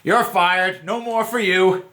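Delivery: fake-fire her with a wink; mock firing. Shown as a demonstration. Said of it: "You'd be like".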